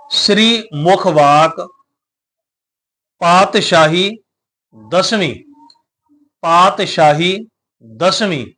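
slow, word by word, clear scripture reading; slow recitation. Shown as a demonstration. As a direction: read it out slowly for pupils to follow. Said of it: "ਦਸਵੀਂ ਪੜ੍ਹਨਾ ਹੈ".

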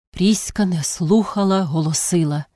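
plain reading voice

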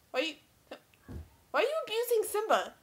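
usual speaking voice

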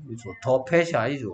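ordinary talk, nothing marked

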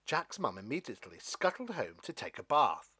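plain reading voice

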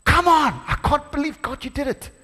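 high, amazed and thrilled; disbelieving joy. Retold or imitated. Preacher voicing David's amazed joy at victory. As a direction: burst out in delighted disbelief. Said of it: "David is feeling this".

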